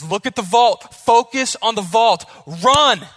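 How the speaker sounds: put-on shouted Russian-accented pep command. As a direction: urge her up like a Russian coach. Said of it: "He's got, like, a Russian accent".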